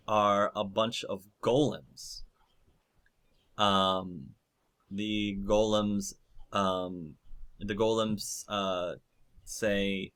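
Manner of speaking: ordinary talk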